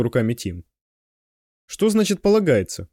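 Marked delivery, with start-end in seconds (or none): 1.6-2.9 a touch of indignant protest; lightly voiced outraged girl; mild indignation. A narrator's reading. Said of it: "возмутилась Мышка". (с лёгким возмущением)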